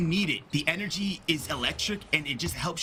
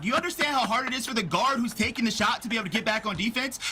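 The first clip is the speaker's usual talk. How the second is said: heated arguing; 8 semitones higher than usual. Real, not acted. Heated basketball argument.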